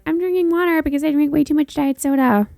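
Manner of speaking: lightly mocking high voice, quick and prim; quoting her past self. Podcaster mocking her earlier self's vow, a touch prim. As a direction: play her own earnest resolution back with a smirk. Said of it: "in the last episode I was like".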